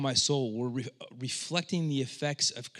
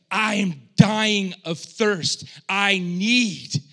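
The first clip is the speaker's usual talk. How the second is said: slow, loud, high strained plea; desperate thirst; 6 semitones higher, 9 dB louder, much slower than usual. Retold or imitated. Preacher voicing the psalmist's desperate thirst, loud strained plea. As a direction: gasp it out like a man dying of thirst. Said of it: "It's like".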